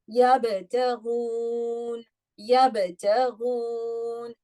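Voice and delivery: yabtaghun slow, ba cut off hard with a bounce; stopped ba. Shown as a demonstration. Teacher recites to class, stopping the ba. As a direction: clip the ba in yabtaghun. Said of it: "Remember, what did I do?".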